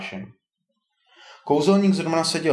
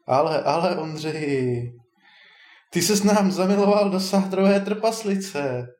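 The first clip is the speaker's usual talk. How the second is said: high, lilting mock reproach; amused teasing; 6 semitones higher than usual. A narrator's reading. Wizard teases Ondřej about being in love, amused. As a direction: tease him in a raised, amused voice. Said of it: "rozesmál se Barnabáš".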